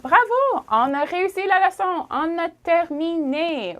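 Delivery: high, loud "Bravo!" then bright praise; cheering praise. Real, not acted. Teacher congratulating learners, excited. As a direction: celebrate the learners finishing.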